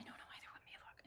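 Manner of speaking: hushed whisper to herself. Real, not acted. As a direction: mutter it under breath, whispered. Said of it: "Why are we whispering?".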